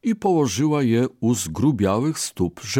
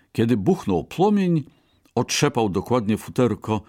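ordinary delivery, nothing marked

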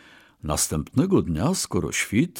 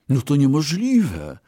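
higher-pitched cry of disbelief; disbelieving exclamation; 6 semitones higher, 3 dB louder than usual. A narrator's reading. Brahmin doubts his ox's plan, exclaiming. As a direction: exclaim that it can't be done. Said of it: "wykrzyknął Bramin".

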